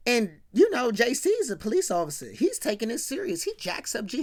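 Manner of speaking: ordinary delivery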